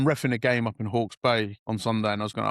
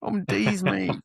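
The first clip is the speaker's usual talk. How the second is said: mocking kid slang; 7 semitones higher than usual. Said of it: "Continue with that".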